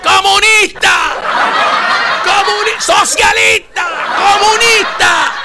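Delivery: mock outraged yelling. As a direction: ham up an outraged partisan yelling communist, laughing. Mocking Cuban Republicans yelling communist.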